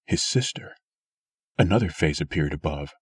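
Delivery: usual speaking voice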